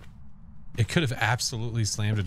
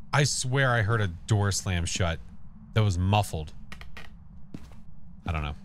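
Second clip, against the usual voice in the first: normal talking voice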